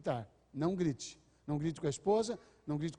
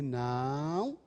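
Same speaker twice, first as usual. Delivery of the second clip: warning no; 3 semitones lower than usual. Retold or imitated.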